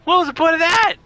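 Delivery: high, loud exasperated outburst; exasperated shout. Real, not acted. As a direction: yell it in disbelief. Game mechanic annoys him.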